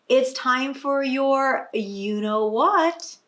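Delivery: slow, coaxing mother voice; mother's voice. A narrator's reading. Mother hinting at naptime to daughter, slow coaxing voice. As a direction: draw it out like a mum hinting at naptime. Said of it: "her mother says".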